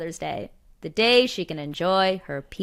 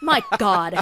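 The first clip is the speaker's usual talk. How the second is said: usual speaking voice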